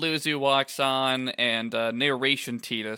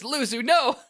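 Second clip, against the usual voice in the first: alarmed cry; 11 semitones higher, 4 dB louder than usual. Acted. Lulu stopping a confession.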